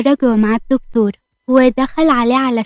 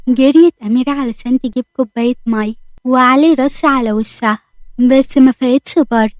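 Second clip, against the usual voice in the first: ordinary delivery, nothing marked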